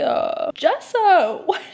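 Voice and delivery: high, loud, drawn-out wail; tearful wail. Real, not acted. Upset reader pleads with the author Jessa, tearful wail. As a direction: wail in protest through laughing tears.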